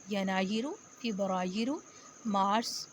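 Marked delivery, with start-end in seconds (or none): none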